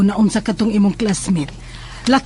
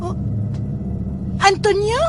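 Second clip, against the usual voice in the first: sharply raised, startled question; surprised; 11 semitones higher than usual. Acted. Mother startled to find her son outside, surprised. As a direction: catch him unexpectedly.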